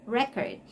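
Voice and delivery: "Record" stressed on the first syllable; "Record" said REK-ord; noun stress. Shown as a demonstration. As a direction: lean on REC in "Record". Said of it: "Record is actually a noun".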